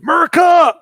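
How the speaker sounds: hammed-up loud tourist crying Merca; mock ugly American. Ugly American tour, bad attitude.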